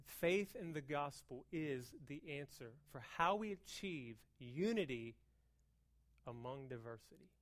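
soft, low, sincere delivery; earnest quiet preaching. Real, not acted. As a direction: drop down and mean it.

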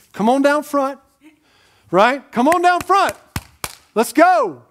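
preacher calling people to the altar, loud and rising. Retold or imitated. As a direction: call them down front like a revival preacher. Imitating altar call preacher.

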